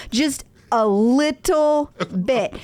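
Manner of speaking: "little bit" drawn out slowly; slow emphasis. Real, not acted. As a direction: stretch "little" and separate it from "bit". Repeats the point for emphasis.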